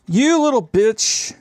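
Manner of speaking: slow high snarl at a part; angry snarl. Real, not acted. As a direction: curse it out slowly. Cursing at stuck part.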